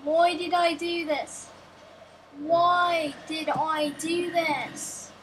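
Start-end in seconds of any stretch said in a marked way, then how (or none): 2.4-5.1 very slow, stretched-out wail of regret; drawn-out lament. Pretend. Player regretting a really bad idea, drawn-out lament. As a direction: drag out each word in mock despair.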